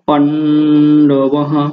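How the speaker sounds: পাণ্ডবাহা drawn out very slowly, ending in a voiced "ha"; Sanskrit pronunciation. Shown as a demonstration. Teacher breaking down the Sanskrit word, পাণ্ডবাহা very slow with clear হা. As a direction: stretch পাণ্ডবাহা syllable by syllable, sounding the final হা. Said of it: "সংস্কৃত".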